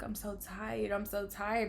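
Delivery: soft, worn-out murmur to mom; imitating her own weary complaint; retold tired plea. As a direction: say it small and drained. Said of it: "I remember telling my mom".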